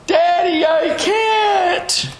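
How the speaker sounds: little daughter whining. Retold or imitated. Little daughter complaining to her dad, high childish whine. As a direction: play a small girl giving up, wailing. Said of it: "my daughter says something like".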